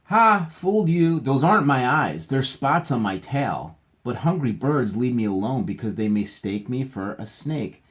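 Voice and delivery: bright read-aloud voice, starting high and loud; reading to children. A narrator's reading. Teacher reads the book's animal answer, playful read-aloud.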